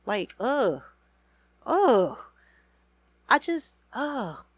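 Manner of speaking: high, loud disgusted ugh; disgusted groan. Real, not acted. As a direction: groan in revulsion. Disgust at Kirk.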